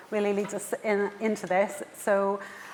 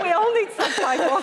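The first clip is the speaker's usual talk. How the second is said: high and loud, laughing through the words; laughing quip; 10 semitones higher, 5 dB louder than usual. Real, not acted. Host joking to panel about subtitles, laughing loud quip. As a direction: toss it off mid-laugh.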